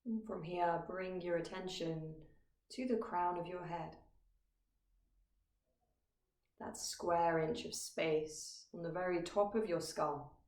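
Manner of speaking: calm, measured meditation guide with long pauses; guided meditation. Acted. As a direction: guide listeners gently into stillness, phrase by phrase. Leads a meditation.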